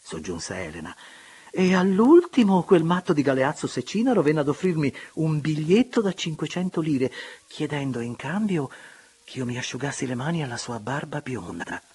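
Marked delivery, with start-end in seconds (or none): 1.5-11.8 higher, louder gleeful anecdote; amused society lady. A narrator's reading. Elena telling the guests about Galeazzo, high and amused. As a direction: relish the scandalous story.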